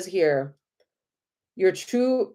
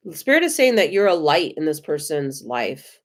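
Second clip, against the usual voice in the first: normal talking voice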